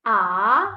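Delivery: long rising AH; second tone. Shown as a demonstration. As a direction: start AH low and glide it up. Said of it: "let's read the tones once more".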